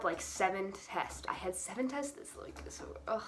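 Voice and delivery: normal talking voice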